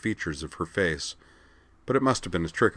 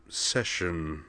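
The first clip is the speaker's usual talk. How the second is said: softer, fading out; trailing off; 5 dB quieter than usual. A narrator's reading. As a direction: let the word die away. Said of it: "voice trailed off".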